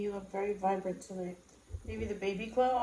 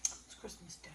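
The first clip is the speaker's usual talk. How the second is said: whispered aside; 3 semitones lower, 11 dB quieter, slower than usual. Real, not acted. Quiet correction whispered.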